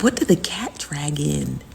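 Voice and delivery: breathy unvoiced whisper of "what did the cat drag in?". Streamer whispering a gossip aside to chat, hushed.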